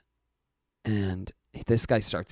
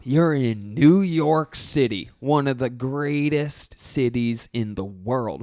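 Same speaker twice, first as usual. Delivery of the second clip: ranting at the crowd; 5 dB louder, slower than usual. Retold or imitated. Comic roasting the Albany crowd, loud and slow. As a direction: drag out the insult to the city.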